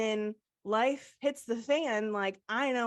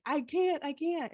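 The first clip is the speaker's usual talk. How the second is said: retold sobbing; 6 semitones higher than usual.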